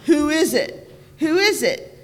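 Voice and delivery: loud, high calling voice; big voice. A narrator's reading. Lizard calling out loudly to whoever comes. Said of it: "The lizard sang out in a big voice".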